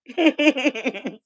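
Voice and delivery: a short, high burst of laughter; real laugh. Real, not acted. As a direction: laugh out at your own joke. Laughs at her own weed joke.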